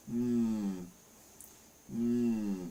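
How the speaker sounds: drawn-out pondering hmm; mock thoughtful hum. Doubting cashless KFC, with a hammed-up thoughtful hum. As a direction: hum like you're mulling it over.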